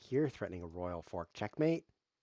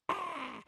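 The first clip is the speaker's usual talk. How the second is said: much higher mock "Ah!" squeal; mock high shriek. Shown as a demonstration. Streamer demoing the typed shout, high mock shriek. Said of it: "That's Shift Alt Ah".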